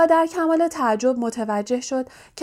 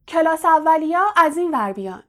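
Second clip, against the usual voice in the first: higher, louder call of a big giant; 6 semitones higher, 4 dB louder than usual. Acted. A familiar giant calling first-years on the cold platform, louder and higher.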